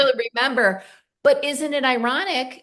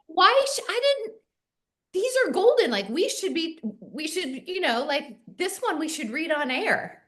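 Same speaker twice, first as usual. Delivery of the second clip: excited amazement; 7 semitones higher than usual. Retold or imitated. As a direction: burst out in delighted disbelief, then fade. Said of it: "and I'm like".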